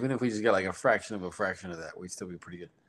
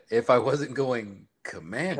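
slow drawn-out mock reply; 4 semitones higher, 6 dB louder, slower than usual. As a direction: drag it out, louder and slow. Acting out guest's reply.

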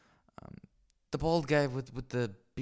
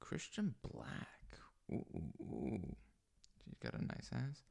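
whisper; 3 semitones higher, 10 dB quieter than usual. Real, not acted. Whispering over a woman's photo.

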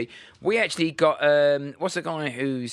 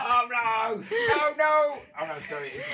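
high-pitched puppet voice breaking into laughter; Zippy; 11 semitones higher, slower than usual. Acted. Impressionist landing on the wrong character, high and laughing. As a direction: slip into a high cartoon voice by mistake. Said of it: "That was Zippy".

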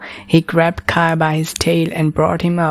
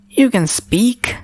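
pitched way up, slow and amazed; a boy stunned that the dragon talks; astonished exclamation; 6 semitones higher than usual. A narrator's reading. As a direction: gasp it out in disbelief. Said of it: "Kai exclaimed".

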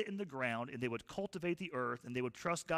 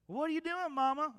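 put-on much higher, curious little boy; child's voice; 12 semitones higher, 4 dB louder than usual. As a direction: play the small son asking his mother. Son voiced in Bible story.

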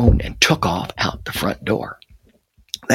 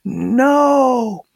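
high, long-held cry of horror; scream; 12 semitones higher than usual. A narrator's reading. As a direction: scream it out in alarm, much higher. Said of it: "Harry screamed".

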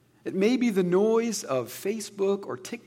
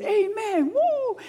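much higher, whooping mock amen; imitating a cheering crowd; much slower than usual. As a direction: play the crowd cheering along, high and bright. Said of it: "I could picture people clapping, saying".

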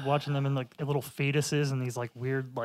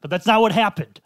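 loud, higher-pitched indignant correction; upset outburst; 7 semitones higher, 11 dB louder than usual. Real, not acted. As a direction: burst out in upset protest. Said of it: "he got real upset about that".

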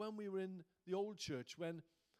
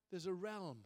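normal talking voice

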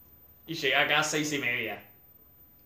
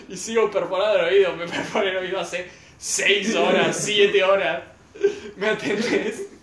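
louder, laughing complaint; 4 semitones higher, 6 dB louder than usual. Real, not acted. Telling friends about the wait, laughing.

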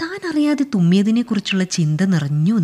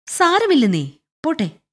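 higher-pitched, offhand brush-off; casually dismissive; 9 semitones higher than usual. Acted. General brushes off the clerk's apology, offhand. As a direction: wave the apology away lightly. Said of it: "നിസ്സാരമായി മറുപടി പറഞ്ഞു".

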